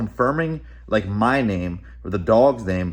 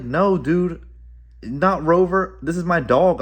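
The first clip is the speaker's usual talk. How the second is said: higher and louder, insisting no, dude, not Rover; exasperated correction; 7 semitones higher, 4 dB louder, slower than usual. Retold or imitated. Retelling to viewers how he told the stranger it's not Rover, exasperated. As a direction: push back hard, half-laughing disbelief.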